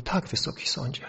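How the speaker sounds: soft, hesitant retold answer; trembling voice. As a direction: mildly imitate an unsure witness answering quietly. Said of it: "z takim drżącym głosem mówi". (niepewny, cichy, lekko drżący głos)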